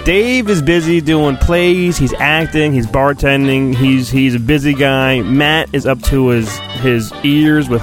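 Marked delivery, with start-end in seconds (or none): none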